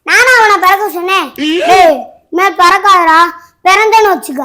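high, loud furious rant; yelling at the birthday brother, furious; angry yelling. Acted. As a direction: shout threats at your little brother. Sibling yells threats at brother.

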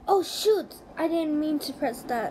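loud, high-pitched cry of dismay; startled outburst. Real, not acted. Player who pressed the wrong thing, startled outburst. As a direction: blurt it out loudly at a sudden slip.

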